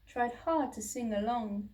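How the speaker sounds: ordinary delivery, nothing marked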